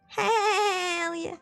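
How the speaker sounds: ordinary talk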